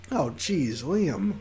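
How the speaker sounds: usual speaking voice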